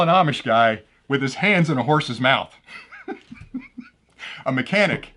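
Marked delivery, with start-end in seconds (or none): 3.1-3.8 high squeaky laugh. Real, not acted. Laughs at own joke. 4.5-5.1 laughing punchline. Real, not acted.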